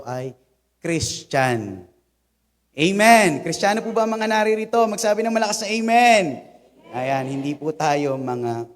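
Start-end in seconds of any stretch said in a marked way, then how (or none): 2.7-6.2 loud, high, quick call to the crowd; rally them with a raised, hurried voice; rousing call. Real, not acted. Preacher asking the congregation for a loud amen, rousing call.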